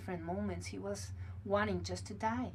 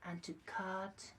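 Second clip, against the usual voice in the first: guided meditation; 4 semitones lower, 6 dB quieter than usual. Acted. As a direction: lull them into calm, close and gentle. Leads a meditation.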